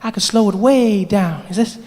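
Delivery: slowing down. Acted. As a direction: stretch WAY DOWN while staying high. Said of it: "That's better".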